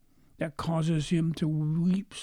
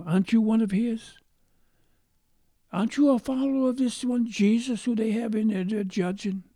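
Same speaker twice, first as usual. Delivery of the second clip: a higher, pointed accusing voice; a suspicious stranger pressing Peter, raised; accusing bystander; 6 semitones higher than usual. Retold or imitated.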